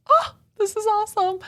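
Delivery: high-pitched, retold squeal of delight; acted-out giddy excitement; thrilled. Retelling being told she is loved.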